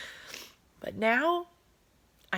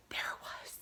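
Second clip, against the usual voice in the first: awed whisper; 12 dB quieter than usual. Real, not acted. Moment of revelation.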